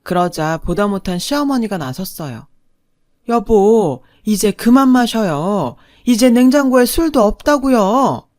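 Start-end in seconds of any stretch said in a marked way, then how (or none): 3.3-8.3 loud, higher-pitched scolding; exasperated wife. A narrator's reading. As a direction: scold your drinking husband, getting higher. Fed-up wife stops drinking husband.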